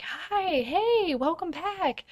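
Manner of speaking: put-on high, sing-out greeting like excited kids; high-pitched, delighted child's welcome; excited children. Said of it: "I hear the other children excited".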